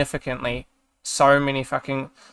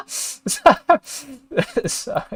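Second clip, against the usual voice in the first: high, helpless real laughter; trying to speak through laughter; cracking up; 13 semitones higher, 5 dB louder than usual. Real, not acted. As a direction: laugh too hard to get the words out. Cracks up after mimicking the accent.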